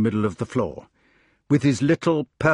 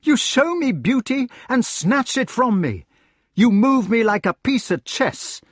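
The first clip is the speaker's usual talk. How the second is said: higher-pitched, wounded accusation; anguished king; 11 semitones higher, 4 dB louder than usual. Acted. King accusing those who twisted his soul, anguished. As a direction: demand an answer in raised, injured tones.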